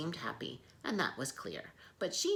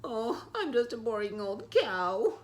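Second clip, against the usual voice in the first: gloomy cow voice; 8 semitones higher, 6 dB louder than usual. Acted. Sad cow replies.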